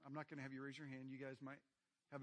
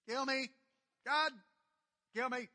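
"Kill me" much higher and louder, a shouted plea; desperate cry; 8 semitones higher, 11 dB louder than usual. Retold or imitated. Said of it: "He says, basically".

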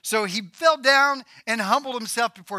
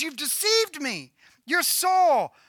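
high-pitched, shocked accusation; mock screaming; 9 semitones higher, slower than usual. Witch realizing her visitor is Saul, mildly screamed accusation. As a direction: act out alarm without a full scream. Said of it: "So she screams".

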